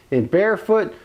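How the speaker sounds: normal talking voice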